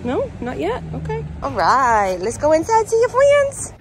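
high cheerful pet voice; talking to a dog. Real, not acted. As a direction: coax the dog, high and bright. Coaxing the dog inside.

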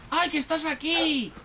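high-pitched delight at finding a dog; happy squeal of recognition; delighted greeting. Real, not acted. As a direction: greet a dog you love, voice way up.

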